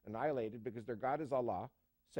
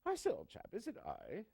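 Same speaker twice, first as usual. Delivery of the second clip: very high, put-on British gentleman; British accent; 9 semitones higher than usual. Judas asks at the Last Supper, British accent. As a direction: joke punchline in a high-pitched British accent. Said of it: "with his British accent".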